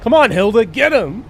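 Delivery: high, loud "come on"; loud cheering. Real, not acted. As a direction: cheer your teammate on. Cheering on an ally in fight.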